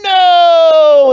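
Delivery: put-on high, loud shout of a defiant toddler; toddler's no. Therapist imitating a toddler refusing, high loud shout. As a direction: yell it like a stubborn little kid.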